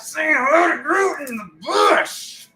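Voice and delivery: loud, high shouted greeting; playful shout. Acted. Host shouting a playful hello to Groot. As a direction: call it out with a big grin.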